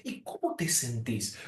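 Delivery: gentle question. Shown as a demonstration. Coach showing how to ask a friend gently. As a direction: ask it tenderly, almost hushed. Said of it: "Preguntémosle a la gente".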